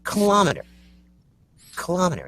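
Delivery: high "Kilometer" as kuh-LOM-eter; high-pitched "Kilometer" with a falling end; kilometer stressed on LOM. Shown as a demonstration. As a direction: hit the AH in "Kilometer". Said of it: "that's the way I want it".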